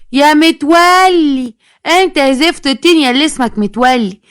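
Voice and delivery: high, drawn-out summons; calling out crossly. Acted. Woman calls out to Metwalli. (نداء ممطوط بزعيق)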